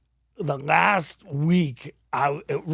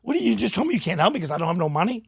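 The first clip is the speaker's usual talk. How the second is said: higher-pitched indignant challenge; retold anger; 6 semitones higher, 3 dB louder, faster than usual. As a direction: confront him, worked up. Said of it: "but I was, like, mad. Like, I'm like".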